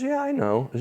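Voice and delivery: high mock reply; quoting the waitress. Waitress answering the preacher, lightly voiced high. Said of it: "She goes".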